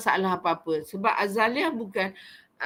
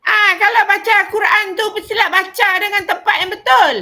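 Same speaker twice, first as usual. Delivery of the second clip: much higher and louder, scolding; fierce scolding voice; 10 semitones higher, 10 dB louder than usual. Shown as a demonstration. A teacher demonstrating a fierce, scolding tone to Quran readers. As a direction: bark it like an angry teacher. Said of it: "kalau dia cakap macam ni".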